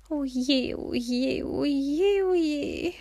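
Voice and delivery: put-on a worried old lady's building whimper; wailing. A narrator's reading. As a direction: lament mounting towards a fretful squeak. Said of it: "jammerte Luise".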